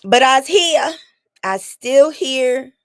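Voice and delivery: a hammed-up "I'm still standing"; playful defiance. Real, not acted. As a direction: make it a triumphant little declaration of survival.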